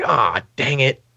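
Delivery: mock dismay, drawn out; exasperated programmer. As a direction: grumble it as the guy handed a lousy assignment. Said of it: "He's like".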